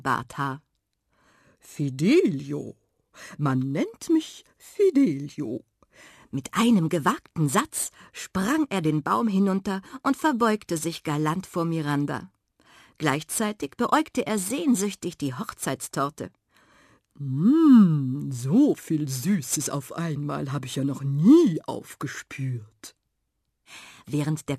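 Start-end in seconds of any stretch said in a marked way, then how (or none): 1.6-5.6 slow, drawn-out self-introduction of a gallant tomcat; suave tomcat. Acted. Tomcat introduces himself in story. 17.2-23.0 gallant tomcat savouring sweets, loud relishing hum; longing tomcat. Acted. Tomcat eyes the cake longingly.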